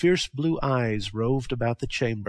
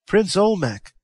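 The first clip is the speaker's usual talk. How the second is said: raised, eager warrior addressing his prince; Techotl; 8 semitones higher, 7 dB louder than usual. A narrator's reading. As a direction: call out to your prince with respect. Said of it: "spoke Techotl, bowing low".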